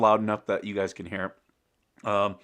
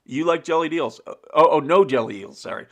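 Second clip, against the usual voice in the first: loud, high, startled; shocked reaction; 5 semitones higher, 8 dB louder than usual. Retold or imitated. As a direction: act out recoiling in alarm. Friends react in mock horror.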